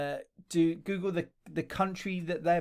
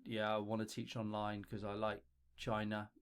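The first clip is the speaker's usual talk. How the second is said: mock careless mumble, softer and lower; lazy intro; 7 semitones lower, 3 dB quieter than usual. Teacher mocking a careless applicant's intro video, bored mumble. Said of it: "Don't just be like".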